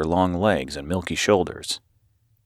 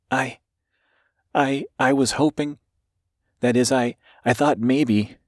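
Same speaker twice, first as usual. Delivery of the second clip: stammering, pitched up; hesitant, nervous; 6 semitones higher than usual. A narrator's reading. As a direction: stammer nervously, on the verge of tears. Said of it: "Her lower lip trembled".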